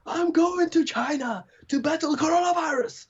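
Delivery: very high-pitched mock battle cry; imitated shouting. Podcaster retelling a Nigerian priest's vow to his church, imitated shouting. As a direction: mimic a zealous priest proclaiming his mission, without real volume. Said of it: "he kept shouting".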